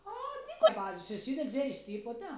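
high loud cry of disbelief, then scolding; shocked scorn. Retold or imitated. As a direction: gasp at her ignorance, then scold. Coworker shocked she knows nothing. (συνάδελφος σοκαρισμένη με την άγνοιά της, ψηλή δυνατή κραυγή)

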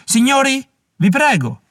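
high pleading appeal; pleading call. A narrator's reading. Warwick on his feet calling to the crowd, high plea. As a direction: plead over the din.

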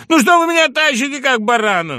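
high, loud, mock protest; squealed good-humoured objection; breaking into amusement. A narrator's reading. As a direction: cry out in comic complaint. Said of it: "наконец рассмеялся он".

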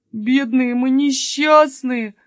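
slow, high, loud wail; plaintive moaning. Acted. Jonatan despairing that Sofia will stay, plaintive moan. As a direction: moan in drawn-out self-pity. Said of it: "жалобно простонал Юнатан".

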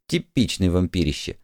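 usual speaking voice

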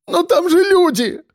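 very high, pleading cry; tearful cry. A narrator's reading. Woman pleads with her rescuer, tearful high cry. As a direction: cry out as if through sobs. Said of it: "крикнула она, всхлипывая".

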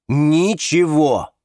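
slow, loud, emphatic refusal; cutting, final. A narrator's reading. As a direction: stretch it out to close the subject. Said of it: "отрезал Александр". (медленно и категорично)

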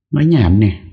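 playful scold. Acted. Wife scolds husband.

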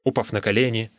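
usual speaking voice

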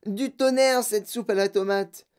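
high-pitched delight, savoring each word; excited raving. A narrator's reading. Girl tasting tomato soup in gum, excited. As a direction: rave over each taste in a high voice. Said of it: "hurla Violette".

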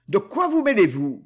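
mild angry growl. A narrator's reading. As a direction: snarl it at the intruder. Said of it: "gronda Luc".

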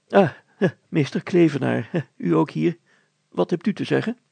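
mayor triumphantly confused; self-satisfied mayor. Acted. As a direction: proudly announce nonsense. Mayor greets teacher.

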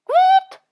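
very high shrill owl cry; eerie bird screech. A narrator's reading. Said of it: "uno spaventoso versaccio". (verso spaventoso di civetta)

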